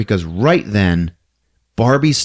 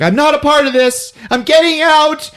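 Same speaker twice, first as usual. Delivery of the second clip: panicky; 3 dB louder than usual. Retold or imitated. Thunderbutt backs out of the fight.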